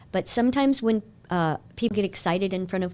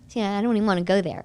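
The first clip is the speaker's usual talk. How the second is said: usual speaking voice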